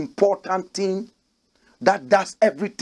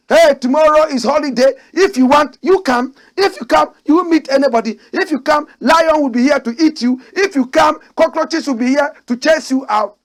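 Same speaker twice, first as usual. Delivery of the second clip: put-on loud, high, sing-song talk to small children; mock the PRO like he talks to toddlers; mock kindergarten teacher; 7 semitones higher, 7 dB louder than usual. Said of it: "talking to some little kindergarten children".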